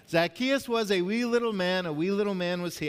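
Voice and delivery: high, loud mock-Irish recital; Irish accent. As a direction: lift it up into a playful Irish accent. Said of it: "trying to say that with an Irish accent".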